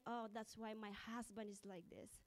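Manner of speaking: ordinary delivery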